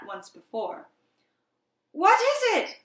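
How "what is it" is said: high, alarmed shout; urgent, high-pitched yell; loud cry. A narrator's reading. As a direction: cry out almost as loud as a scream. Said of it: "I cried, hardly less loud".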